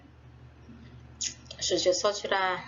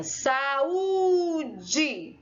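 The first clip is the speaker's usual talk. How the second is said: "saúde" with a very long, higher ú; exaggerated long stress; 4 semitones higher than usual. Shown as a demonstration. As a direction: hold the ú in "saúde" for ages, then drop to "de". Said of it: "eu demorei mais a falar".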